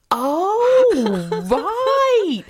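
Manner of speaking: high loud dawning shock; shocked realisation. Retold or imitated. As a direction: let the penny drop, squeaky and loud. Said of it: "I said".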